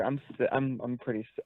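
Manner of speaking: usual speaking voice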